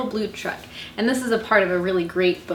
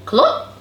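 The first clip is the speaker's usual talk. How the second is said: high, louder cluck; hen cluck; 4 semitones higher, 5 dB louder than usual. A narrator's reading. Chicken says hello to Blue, high cluck. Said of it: "said the chicken".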